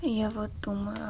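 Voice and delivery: ordinary talk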